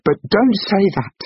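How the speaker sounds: high, urgent pleading; loud, strained appeal; distressed plea. A narrator's reading. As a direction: beg him to take it back. Wife pleading after shocked gasp.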